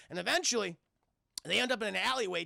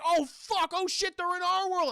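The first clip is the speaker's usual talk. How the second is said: panicked cry; 9 semitones higher, 5 dB louder than usual. Retold or imitated. Danny panics at portal escape.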